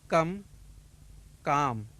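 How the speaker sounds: कम with short अ, काम with long आ; minimal pair demo. Shown as a demonstration. Teacher contrasting कम and काम for students. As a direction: set कम against काम clearly. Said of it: "दो शब्द सुनिए".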